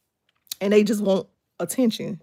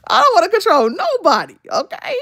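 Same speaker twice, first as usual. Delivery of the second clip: high laughing protest; 9 semitones higher, 5 dB louder than usual. Real, not acted. Mother joking about her kids, laughing high. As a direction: laugh while you say it.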